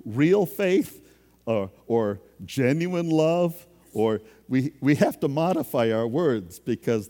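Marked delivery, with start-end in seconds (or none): 0.0-1.0 "real" raised and louder; "real" pushed up and loud; stress on real. Retold or imitated. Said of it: "We go". 2.5-3.6 "genuine" slow and louder; drawn-out emphatic "genuine"; "genuine" stretched and stressed. Retold or imitated. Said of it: "We go".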